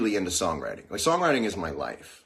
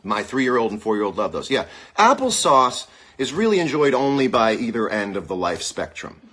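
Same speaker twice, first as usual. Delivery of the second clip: ordinary talk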